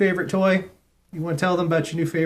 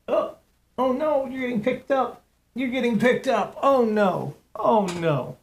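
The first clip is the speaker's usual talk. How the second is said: higher, hammed-up "oh no" in a put-on cartoonish voice; mock alarm; 8 semitones higher than usual. Teasing the cat as she picks him up, mock alarm. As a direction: pretend to panic for the cat's sake.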